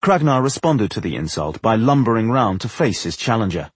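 ordinary delivery

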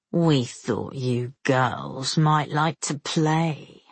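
low, slow, oily menace; oily blackmailer purr. A narrator's reading. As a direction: purr it, slow and greasy with threat. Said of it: "Semyon's deep, oily voice".

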